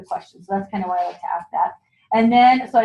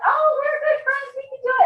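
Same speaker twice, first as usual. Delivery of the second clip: mimicking guests. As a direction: play the chummy guests, pitched way up. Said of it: "people are like".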